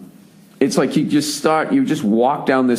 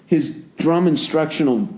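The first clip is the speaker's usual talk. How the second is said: usual speaking voice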